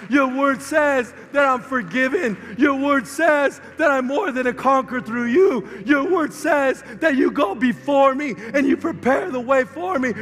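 loud, slow declarations of faith; shouted prayer. Retold or imitated. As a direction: proclaim each promise loudly and slowly. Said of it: "put your heart in God's word and say".

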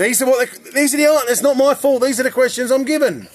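loud, high-pitched defensive outburst; indignant protest. Real, not acted. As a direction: protest loudly over everyone, pitch jumping up. Host protests against quiz complaints.

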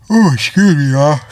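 words stretched through a big real yawn; talking to viewers through a real yawn. Real, not acted. Apologising to viewers mid-yawn, yawning. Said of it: "I'm leaving that yawn in there".

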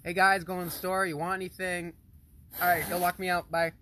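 high, loud casual call-out; example announcement. Shown as a demonstration. Showing dad how to announce leaving, high breezy call-out. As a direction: call it quickly to the household on the way out. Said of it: "Just say".

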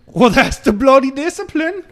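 a mock British hardman, pushed up and loud; high, loud fake British accent. Tough guy defending his discipline in a loud fake British accent. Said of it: "The last shitty accent I can pull out".

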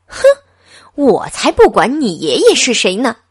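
a scornful snort, then a loud comeback; sharp retort. A narrator's reading. Clerk talking back to the gentleman, scornful. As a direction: dismiss his grandfather with contempt. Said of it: "女店员顶他一句".

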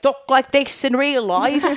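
high, slow mock British accent; bad British accent. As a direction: badly imitate a British actor's real accent. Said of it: "more like an old style British accent".

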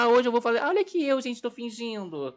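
mocking influencer voice. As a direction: ham up a vain vlogger.

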